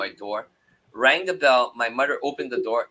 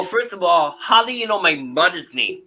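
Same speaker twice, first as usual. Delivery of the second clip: louder, higher mock indignation; retold indignant challenge; 7 semitones higher, 7 dB louder than usual. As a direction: challenge him, reenacting your disbelief. Retold challenge to his son.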